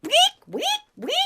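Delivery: high squeaky guinea pig weeks; guinea pig squeak. Retold or imitated. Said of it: "he's having a guinea pig".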